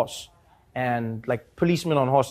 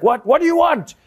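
high, loud imitation cop; mock exasperated police voice; irritated policeman; 12 semitones higher, 6 dB louder than usual. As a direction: demand it as a bothered officer. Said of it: "the cop is like".

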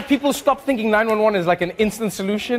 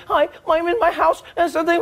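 caller; 11 semitones higher than usual. Retold or imitated. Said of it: "And I'd be like".

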